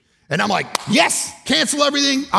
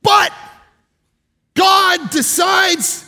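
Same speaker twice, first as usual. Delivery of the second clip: higher, louder, slow, drawn-out proclamation; preaching at full force; 12 semitones higher, 7 dB louder, slower than usual. Acted. Preacher proclaims God's grace to the congregation, loud and slow. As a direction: preach it to the back row.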